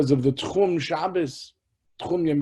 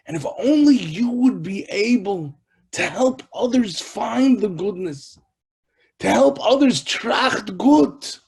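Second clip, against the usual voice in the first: loud, high, emphatic preaching; impassioned exhortation; 6 semitones higher, 7 dB louder than usual. Real, not acted. Preacher urging listeners to help others, impassioned exhortation. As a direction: urge the listeners on with rising fervor.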